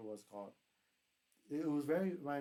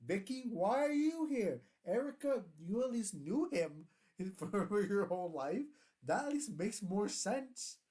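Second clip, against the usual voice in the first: high, loud, slow mock outrage at the crowd; incredulous mock disbelief; 7 semitones higher, 4 dB louder, slower than usual. Reviewer mocking the finale guests, incredulous and high-pitched. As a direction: act baffled, drawing out each name in disbelief. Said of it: "I'm like".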